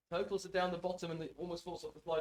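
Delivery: ordinary talk, nothing marked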